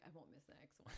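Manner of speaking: whispered small promise; meek whisper. Retold or imitated. Sheepish student whispers a promise to her mentor. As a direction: promise it under your breath.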